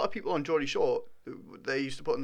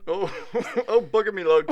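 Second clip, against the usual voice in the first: put-on higher, louder laughing old-man line; old grandad voice; 7 semitones higher, 9 dB louder than usual. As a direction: ham up an old Geordie grandad. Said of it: "What do you say?".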